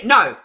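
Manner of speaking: No said loud and round, no R sound; loud demo of No with no R; emphatic no. Shown as a demonstration. Proving to her friends she says No without an R.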